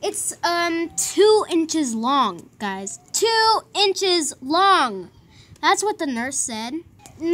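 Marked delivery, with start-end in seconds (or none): 1.0-2.5 "two inches long" slow, each word weighted; emphatic. Real, not acted. Telling viewers how big the cut is, emphatic. 3.1-5.1 "Two inches long" very slow, word by word; emphatic. Real, not acted. Repeating the cut's size to viewers, emphatic.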